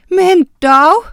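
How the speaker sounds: højt, lyst og henrykt udbrud; leende udbrud. A narrator's reading. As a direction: udbryd muntert overrasket. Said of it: "lo Miranda". (glad forbavselse, højere i tonen)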